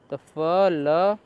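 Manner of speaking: slow syllable-by-syllable फ ल; sounding out phonics. Shown as a demonstration. Teacher sounding out letters slowly for students. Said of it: "एल को पढ़ते हैं ल".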